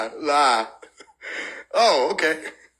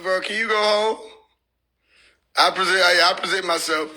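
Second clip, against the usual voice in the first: ordinary delivery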